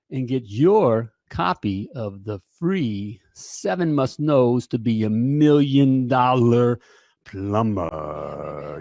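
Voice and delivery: slow, dragged-out title, dropping very low on "plumber"; drawn-out sales plug. Acted. Host plugging his free booklet to viewers, slow and hammed-up. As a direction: stretch each phrase and sink deep on the last word.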